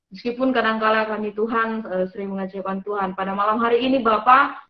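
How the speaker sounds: earnest spoken prayer. Acted. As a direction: pray aloud, sincere and measured. Opening prayer for the session. (doa yang khusyuk)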